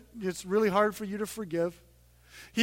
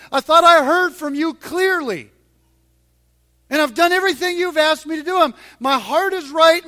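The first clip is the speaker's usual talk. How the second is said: loud, higher, building to a shout; desperate outcry; 8 semitones higher, 10 dB louder than usual. Retold or imitated. Moses crying out to God, building to a shout. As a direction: cry out, hurt and let down. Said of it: "He's just very honest".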